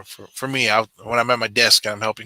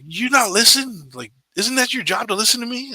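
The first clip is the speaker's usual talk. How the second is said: angry customer; 12 semitones higher than usual. Retold or imitated. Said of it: "he's like".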